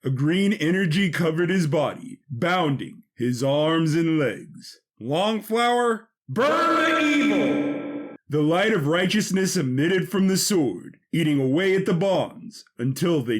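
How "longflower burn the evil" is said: high, slow, drawn-out heroic call; heroic battle cry. Acted. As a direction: call the sword's power upward. Hero invoking sword.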